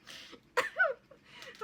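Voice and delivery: big squealing laugh; high burst of laughter. Real, not acted. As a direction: crack up at your own joke. Laughing at her own joke.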